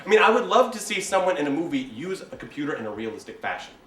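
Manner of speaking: ordinary delivery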